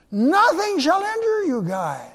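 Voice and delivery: high, ringing declaration; proclaiming. Acted. Preacher voicing the Savior's promise to disciples, high proclamation.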